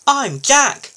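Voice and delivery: high, loud, keen little loader; perky high character voice offering help; eager newcomer. A narrator's reading. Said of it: "Jack whirred".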